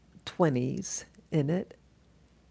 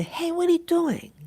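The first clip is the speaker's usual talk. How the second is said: high-pitched yell after a thief, held back; retold yell; 13 semitones higher, 4 dB louder than usual. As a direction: call out in protest, high but only somewhat louder. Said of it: "And I yell at him".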